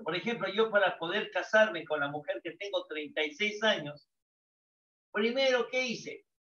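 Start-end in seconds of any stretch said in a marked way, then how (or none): none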